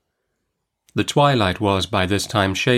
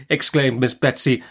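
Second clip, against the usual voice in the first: usual speaking voice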